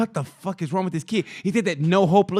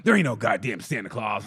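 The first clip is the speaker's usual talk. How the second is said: lower, world-weary mock kid; jaded kid; 7 semitones lower than usual. Jaded nine-year-old telling everyone off, low and weary. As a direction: grumble it like a bitter tiny cynic.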